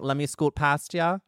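high-pitched mock Minnesota accent. Host teasing guest about Midwestern roots, playful Minnesota accent. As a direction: put on a polite Minnesotan squeeze-by. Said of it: "Do you have a Minnesota accent".

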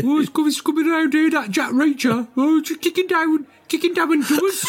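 very high falsetto caricature; hammed-up, high-pitched character voice; comic put-on voice. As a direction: squeal it in a silly falsetto.

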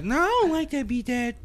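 put-on high kid voice. Son rejecting dad's beat, high whiny kid voice. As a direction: squeak it like a small child. Voicing his son again.

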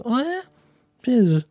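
high, understated 'oh really' reaction; mock surprise. People hearing of his unexpected podcast, mildly surprised.

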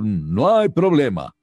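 high, loud, slow alien voice; Alf voice. Retold or imitated. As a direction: stretch it out in the puppet voice. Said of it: "que dijera así". (voz de Alf, aguda y lenta)

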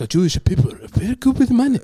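high-pitched put-on Japanese accent; mock Japanese accent. Joking to co-host about Japanese views, in mock Japanese accent. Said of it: "I like that you do the voice".

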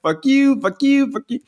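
high-pitched little kid imitation; little boy. Quoting a kid from a video, high mimicking voice.

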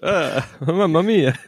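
slow, high mock Italian exclamation. Mocking the old Italian woman, a slow high Italian accent.

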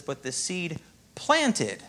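"planted" stretched slow and leaned on; slow right down and hit "planted"; "But the seed planted" with weight on "planted"; slow stress on planted. Shown as a demonstration. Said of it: "if you slow this down and look".

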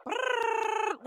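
high-pitched rolling brrr; wound-up motor noise. Retold or imitated. Medium imitates mom's nonstop chatter, high motor trill. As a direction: trill like a toy running nonstop. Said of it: "literally like the Energizer Bunny".

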